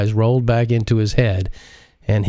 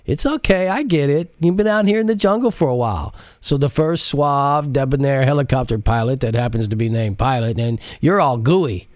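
higher teasing male sidekick voice; Jacinto teasing; 6 semitones higher than usual. Acted. Jacinto mocks Modise for flirting, in a higher teasing voice. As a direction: rib her about the pilot, higher voice.

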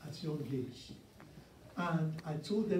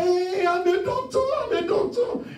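much higher and louder, a gleeful mocking crow; imitating a proud new doctor crowing over his results; bragging classmate; 11 dB louder than usual. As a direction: shout it like a cocky student who just passed. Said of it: "he said".

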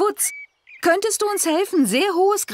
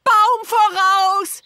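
calling out; 6 semitones higher, slower than usual. Acted. Warning of a tree ahead.

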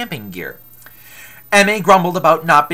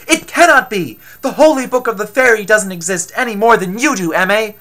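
loud, raised-pitch protest; exclaimed disbelief; 5 semitones higher, 6 dB louder than usual. Acted. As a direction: exclaim it as if it cannot be true. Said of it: "exclaimed Athame".

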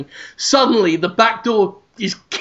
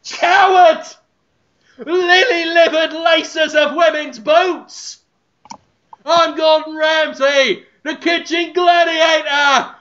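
high, slow bellowed insults; Ramsay yelling at the owner through the door, high and slow; furious shouted insults; 8 semitones higher than usual. Acted. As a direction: hurl every word at the shut door. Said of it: "and he shouts".

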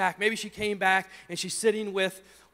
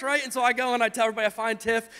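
normal talking voice

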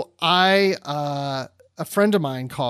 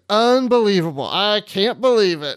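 louder, higher retold amazement, laughing at the end; amused disbelief; 4 semitones higher, 4 dB louder than usual. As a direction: imitate her exclaiming in disbelief, then laugh. Acting out her laughing disbelief.

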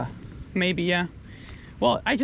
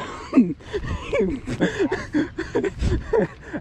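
amused laugh; 14 semitones higher than usual. Real, not acted. Laughing at fish size claim.

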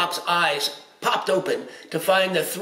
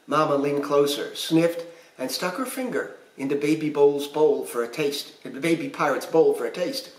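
ordinary delivery

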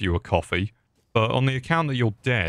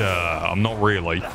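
ordinary talk

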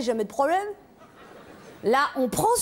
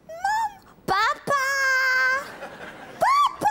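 very high calls for dad; child calling; 8 semitones higher than usual. Acted. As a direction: shriek out like a child in bed. (appel d'enfant aigu)

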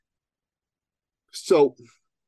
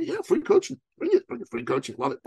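excited; 12 semitones higher than usual. Retold or imitated. Eager reply to free offer.

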